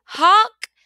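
high, loud frightened honk; scared honk. A narrator's reading. The dump truck, stuck in the mud, honks in a scared cry. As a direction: honk out in panic, stuck fast. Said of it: "cried the dump, and he sounded scared".

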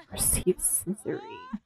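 high breathy gasp of disbelief; gasp, then read the option in shock. Real, not acted. Streamer spotting an outrageous game option, gasping.

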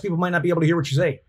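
rushed, very fast delivery; talking too fast. Shown as a demonstration. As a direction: race through the words like a nervous speaker. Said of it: "If you're talking too fast".

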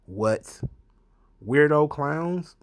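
slow, drawn-out incredulous question. Real, not acted. Vlogger repeating a quote from the statement, incredulous. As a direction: repeat the phrase slowly in disbelief. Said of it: "mocking the quote".

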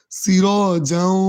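"যাও" held with a nasal hum into the w; slow nasal glide on "যাও"; ghunnah demonstration. Shown as a demonstration. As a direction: stretch "সির", then hum "যাও" through the nose. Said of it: "এক আলিফ পরিমাণ গুন্না করে পড়তে হবে".

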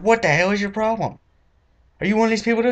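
high, indignant questioning; mock-outraged interrogation; accusing rant. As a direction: grill the cashier suspiciously. Confronting the cashier.

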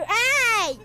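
loud high yell at the ferret; sharp shout. Real, not acted. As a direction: snap a loud hey to stop the pet. Shouting at staring pet ferret.

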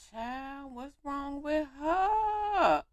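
high, slow, loud wail of concern; dramatic concern. Real, not acted. Gamer gasping at a sad character. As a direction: drag it out in shocked worry.

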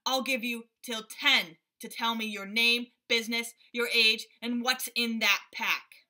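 loud, demanding gorilla; gruff gorilla. A narrator's reading. Gorilla demanding answers from the father, loud. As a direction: bellow it like an impatient ape. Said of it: "And he began counting".

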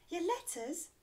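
high surprised questioning elf; little elf's cry. A narrator's reading. Elf questioning Santa's favourite job, high cry. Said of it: "cried the little Christmas elf".

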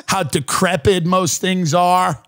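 ordinary talk, nothing marked

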